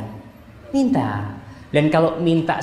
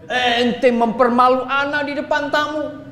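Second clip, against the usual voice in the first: mock angry husband; 7 semitones higher than usual.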